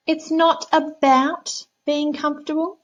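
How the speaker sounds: high, slow smug cat voice; bossy cat. Acted. Cat scolding the frog, high slow prim voice.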